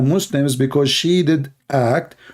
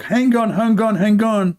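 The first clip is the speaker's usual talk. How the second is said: higher coaxing call to the cat, hang on; pleading with the cat to stay put; mimicking the cat owner; 5 semitones higher than usual. Mocking the video maker calling his cat to stay. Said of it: "So he asked the cat to stay there, like".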